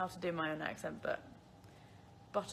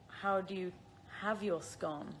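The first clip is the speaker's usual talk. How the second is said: ordinary talk, nothing marked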